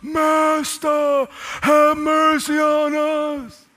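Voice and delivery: high, long-drawn calling; sustained high cry for mercy; lifted-up voices. Acted. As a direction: call out from far off, holding each word. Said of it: "they lifted up their voices".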